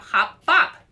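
high, loud and bouncy; bright game name. A narrator's reading. Louise proposing her game to Arnold, bouncy and loud. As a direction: announce it like the best game ever.

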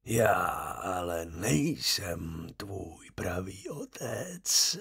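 slow, soft, breathy whisper from the grave; a dead man calling his son, slow and eerie; ghost of the dead father. A narrator's reading. (pomalý šeptavý hlas ducha mrtvého otce)

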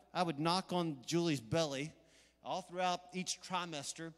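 ordinary talk, nothing marked

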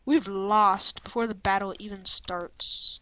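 ordinary delivery

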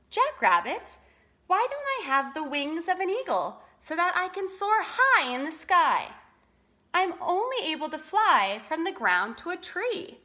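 lightly voiced bird asking why; wistful roadrunner. A narrator's reading. Roadrunner asks Jackrabbit why she can't soar, wistfully.

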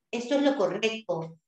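usual speaking voice

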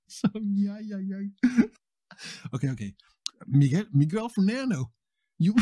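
breaking up laughing mid-line; can't keep a straight face. Real, not acted. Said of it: "Ok, I'm serious now".

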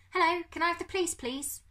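high, fast, polite request; put-on caller voice. Bertolt asking operator for police, high-voiced. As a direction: pitch it up and hurry it. Boy phoning police with prepared speech.

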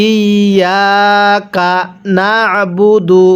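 slow, drawn-out ইয়া কা না আবু দু; model recitation. Shown as a demonstration. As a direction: hold ইয়া long and press the ayn in না আবু দু. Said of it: "কীভাবে শিখব".

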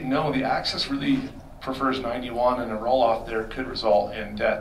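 ordinary talk

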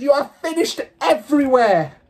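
high, loud raging threats; maniacal rant. A narrator's reading. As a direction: rant with a boiling face. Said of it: "ranting like a maniac".

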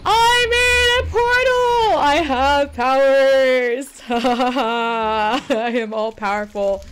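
slow, loud, sung-out grandiose proclamation; mock all-powerful. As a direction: ham it up like a mad sorceress. Gloats grandly over new portal.

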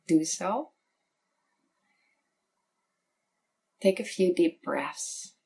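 calm meditation guide, long pauses between phrases; unhurried, soothing meditation voice; guided meditation. Real, not acted. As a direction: lead the listener gently inward, leaving space after each phrase.

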